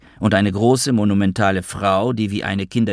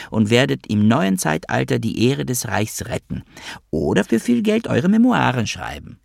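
singsong put-on mother, pitched up with wide swings; Magda, high female voice; 4 semitones higher than usual. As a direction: act out bedtime orders in a high woman's voice. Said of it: "mit hoher weiblicher Stimme". (hohe, gespielte Mutterstimme mit großen Melodiebögen)

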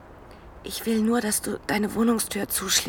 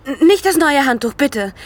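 pleading protest; 5 semitones higher, 7 dB louder than usual. Acted. Injured woman protests loudly.

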